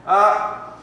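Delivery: ordinary talk, nothing marked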